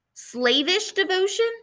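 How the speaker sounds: ordinary delivery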